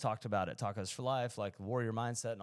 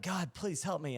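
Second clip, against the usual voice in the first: higher-pitched mock plea; retold frustration; 7 semitones higher than usual. Retelling a plea over a stuck hot tub drain, frustrated. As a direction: replay an exasperated little prayer. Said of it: "I'm, I'm frustrated".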